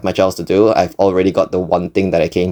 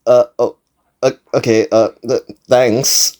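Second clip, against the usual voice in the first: halting, broken-up nervous reply; nervous stuttering; 2 semitones higher than usual. Retold or imitated. Narrator awkwardly thanking the clerk, imitated nervous stutter. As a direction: stumble through it in false starts. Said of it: "shaking, and stuttering, I go".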